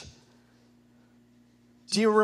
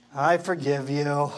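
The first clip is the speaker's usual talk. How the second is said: lower, reluctant "I forgive you"; grudging forgiveness; 5 semitones lower than usual. Shown as a demonstration. Pastor voicing a reluctant forgiver, grudging and low. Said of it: "the more passion you have for Jesus".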